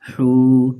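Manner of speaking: ہو held long and loud; drawn out hoo. Shown as a demonstration. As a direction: stretch the oo of ہو. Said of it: "کھینچیں گے تھوڑا". (کھینچ کر ہو)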